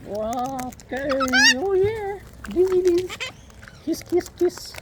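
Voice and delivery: squeaky high cooing. Real, not acted. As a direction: coo up high to the bird. Baby talk to pet bird.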